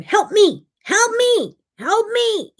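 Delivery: put-on very high, loud toddler demand; high-pitched, urgent little-kid holler; insistent toddler. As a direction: imitate a toddler nagging for help, escalating. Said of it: "She kept going".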